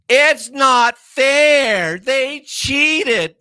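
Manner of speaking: high, slow, whining mockery of a sore loser; mock whiny loser. Mocking sore losers who blame cheating, high whiny whine. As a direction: sneer it out like a crybaby who lost. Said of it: "the way the fucking losers always do it".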